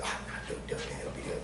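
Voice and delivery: unvoiced, egging him on; whispered urging. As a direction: whisper it like a secret dare. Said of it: "Glenn said".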